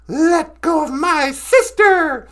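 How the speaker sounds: loud, drawn-out order; slow, forceful story-voice shout; protective command. Acted. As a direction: play a fierce rescuer, loudly. Lilith attacks Santa to rescue sister.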